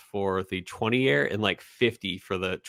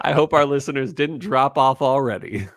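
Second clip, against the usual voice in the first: amused, slightly higher, through laughter; laughing; 4 semitones higher than usual. Real, not acted. Host joking about losing listeners after singing, laughing. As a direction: say it while cracking up.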